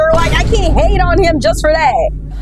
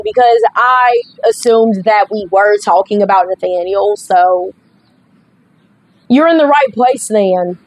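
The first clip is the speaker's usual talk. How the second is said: ordinary talk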